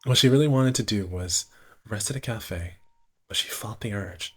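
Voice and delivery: ordinary talk, nothing marked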